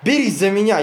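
high, loud urgent call; shout. Acted. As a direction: call to the wounded man. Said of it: "Сильвестр кричит Порфирию".

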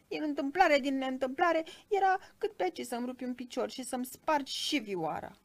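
high, loud, grumbling little creature; indignant tiny cricket. A narrator's reading. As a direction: complain as a small cricket nearly crushed. (greieraș bosumflat, cu voce subțire)

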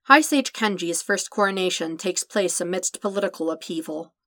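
plain reading voice

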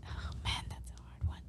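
whispered aside, stumped; hushed, breathy-free whisper as if thinking aloud. Real, not acted. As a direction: whisper it off-mic, passing the question on. Stumped by the food question.

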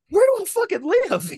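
high, loud incredulous cry; exasperated outburst. Acted. Driver reacts to his strange neighbors, high loud exasperation. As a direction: burst out in comic disbelief.

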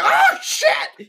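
high startled shout; sudden high-pitched alarmed cry; retold fright. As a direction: yell it like you just saw a kid. Acting out own fright at the scream.

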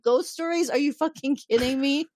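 high incredulous outburst, imitating; mock indignant disbelief. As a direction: scoff as if insulted, hammed up. O'Brien scoffs at ghost story.

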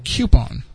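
"Coupon" with a y-glide, KYOO-pon. Shown as a demonstration. Host demonstrating the misfit spelling, "Coupon" as KYOO-pon. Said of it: "it would have to be C U".

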